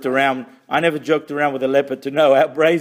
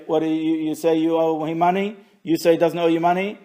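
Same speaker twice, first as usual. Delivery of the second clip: ordinary talk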